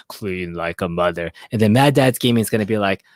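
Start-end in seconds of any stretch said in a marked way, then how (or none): 0.1-1.3 much lower, slower, deep and heavy; Joel's deep voice. Retold or imitated. Mimicking Joel's deep voice to the host. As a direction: drop the voice low and drag it out. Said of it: "Joel has a really deep voice like this".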